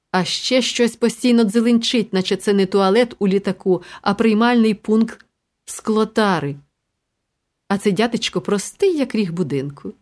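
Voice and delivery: usual speaking voice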